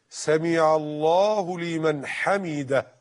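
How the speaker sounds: slow, every word drawn out and articulated; clear, distinct wording. Shown as a demonstration. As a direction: recite it slowly so each word stands clear. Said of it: "ألفاظه كده مفسرة وواضحة". (ببطء، بألفاظ مفسرة وواضحة)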